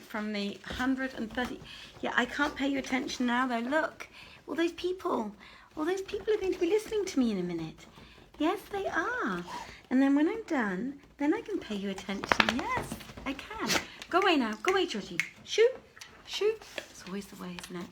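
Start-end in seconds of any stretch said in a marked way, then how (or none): 2.0-13.7 high, sing-song voice cooing at a pet. Real, not acted. Talking to her cat Georgie. 14.1-16.5 shooing the cat. Real, not acted.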